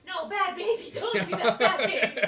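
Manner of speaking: loud, laughing scold of a bad baby; mock scolding shout. Scolding the baby on TV, laughing loud shout. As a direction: yell at the TV like scolding a pet. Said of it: "I was like".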